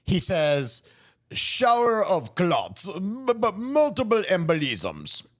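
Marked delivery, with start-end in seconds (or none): 1.3-5.2 put-on slow, amateur Eastern European doctor accent; hammed-up Boris-and-Natasha style Eastern European accent. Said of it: "thick Eastern European accent".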